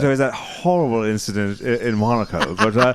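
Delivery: lightly posh high-society voice; a hint of a snooty aristocrat; posh socialite voice. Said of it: "You've got such a voice on you".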